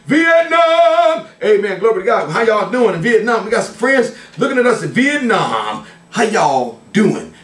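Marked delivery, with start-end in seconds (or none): 0.1-1.3 high, drawn-out shout-out; pitched way up, held long; calling out. Real, not acted. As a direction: holler it high to faraway viewers. Calls out to viewers in Vietnam.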